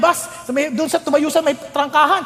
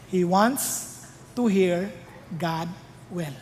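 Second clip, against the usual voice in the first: slow, soft emphasis; 6 semitones lower, 6 dB quieter, much slower than usual. Shown as a demonstration. Emphatic point.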